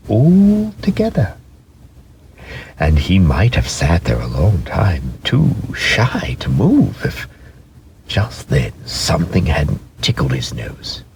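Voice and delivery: usual speaking voice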